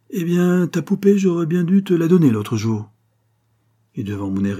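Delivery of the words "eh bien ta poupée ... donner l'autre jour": beaucoup plus haut et plus fort, navré; brocanteur plein de regret. Acted. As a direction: avouer avec regret, voix montée. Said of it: "d'une voix pleine de regret". (le brocanteur avoue son regret à l'enfant, voix haute et forte)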